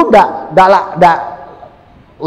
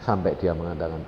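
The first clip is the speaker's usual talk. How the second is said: ordinary talk, nothing marked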